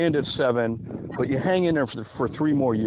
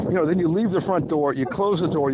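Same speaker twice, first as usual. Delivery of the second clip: normal talking voice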